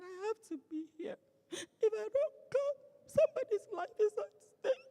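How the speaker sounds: high, crying plea, slowing down; tearful pleading. Retold or imitated. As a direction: beg through tears to be let on the plane. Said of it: "I was crying".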